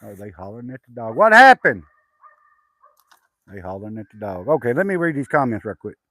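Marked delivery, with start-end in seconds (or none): 1.2-1.8 sudden loud, high shout; real calling out. Real, not acted. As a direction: holler it across the yard. Hollering towards the dog commotion.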